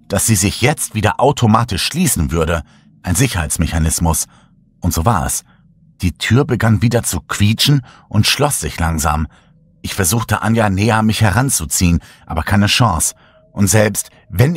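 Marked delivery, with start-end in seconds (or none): none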